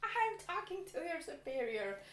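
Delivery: high-pitched indignant threat, acted out; raising hell. Retelling her row with the pool worker, indignantly. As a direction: threaten to go over his head, mock-outraged. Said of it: "just raised all hell".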